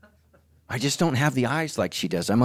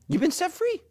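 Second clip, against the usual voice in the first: high exultant. Real, not acted. Sermon climax.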